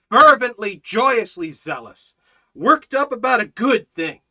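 loud, punchy rallying call; fervent rallying sermon; fiery preaching. Acted. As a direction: stir them up, punch each phrase. Preaching at full force.

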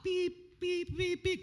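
very high beeping; high mock reverse alarm; reversing truck beep. Shown as a demonstration. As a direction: imitate a truck backing up. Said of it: "do the truck thing".

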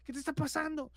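high-pitched alarmed mock yell; mother's panicked yell. Mother alarmed at her screaming son, mock yelling. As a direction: shriek the question, holding volume back. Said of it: "Gritó".

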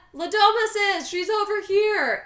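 high, loud tattling cry; hammed-up alarm call; mock scream. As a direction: imitate calling everyone over at full volume. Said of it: "Dora is screaming for everyone".

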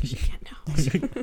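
slow secretive whisper; whispered slowly to herself. Retold or imitated. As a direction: hush it like a secret. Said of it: "I was like".

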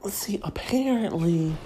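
hushed whisper to open the gossip; conspiratorial unvoiced whisper. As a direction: lean in and whisper like sharing a secret.

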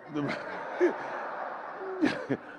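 breathy falling laugh; amused laugh. Real, not acted. Preacher laughing at how he answered his son, breathy laugh. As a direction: laugh at your own punchline.

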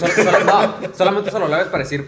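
边笑边说，声音高而响; 笑着快速解释; laughing while talking. Real, not acted.

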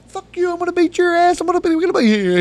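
very high, loud mock threats; ranting son. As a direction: mimic the boy's furious blustering in a high voice. Voices son's angry threats.

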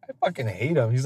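normal talking voice

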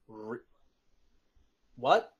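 incredulous, rising in disbelief; what, really?; surprised. Acted. NPC reacting in disbelief.

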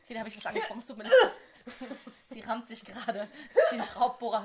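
loud laughter breaking into words; laughing. Real, not acted. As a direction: laugh hard while talking. Laughing at friend's mishap.

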